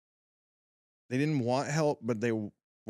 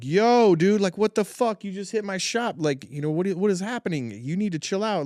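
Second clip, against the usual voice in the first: retold confrontation; 6 semitones higher, 4 dB louder than usual. Confronting driver who hit shop.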